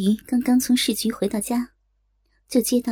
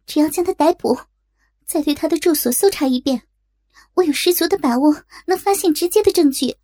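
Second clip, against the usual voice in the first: higher-pitched, emphatic assurance; confident; 5 semitones higher, 4 dB louder than usual. A narrator's reading. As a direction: argue the case with full conviction. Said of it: "胸有成竹地说". (胸有成竹、语气坚定)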